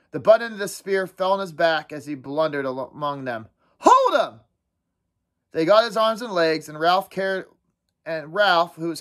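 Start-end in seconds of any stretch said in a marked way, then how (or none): 3.8-4.4 high, loud frenzied command; shouted order. Acted. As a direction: yell to the pack. Boys shouting in mobbing game.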